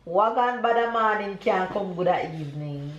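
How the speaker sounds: full Jamaican proverb, patois rhythm. Shown as a demonstration. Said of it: "I'm gonna say everything all at once".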